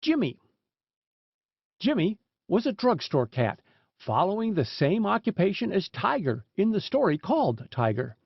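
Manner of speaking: ordinary delivery, nothing marked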